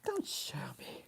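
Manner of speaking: real hushed whisper. Real, not acted. Host jokes with viewers about weird tongues, whispering. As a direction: whisper it, all breath and no voice. Repeats it whispered after laughing.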